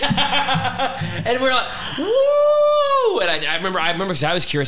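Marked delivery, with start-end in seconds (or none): 2.0-3.2 very high, loud drawn-out woo; hammed-up cheer, sailing up high; celebratory whoop. Couple celebrating paid-off phones, high mock whoop.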